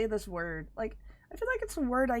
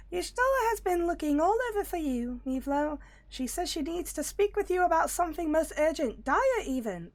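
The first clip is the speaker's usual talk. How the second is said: squeaky rabbit voice, much higher; high-pitched Loporrit voice; 7 semitones higher than usual. Acted. Rabbit creature urging the hero to hurry, high-pitched. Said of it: "this is a high-pitched voice".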